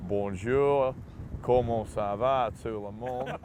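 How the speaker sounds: a hint of clumsy French in an Aussie accent; slow, lightly mocked Aussie French greeting. Player imitating coach greeting in Aussie-accented French. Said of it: "French with an Aussie accent".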